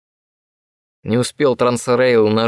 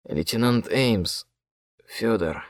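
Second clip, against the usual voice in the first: faint delirious murmur; dreamy distant voice; 6 dB quieter, slower than usual. A narrator's reading. Delirious after waking.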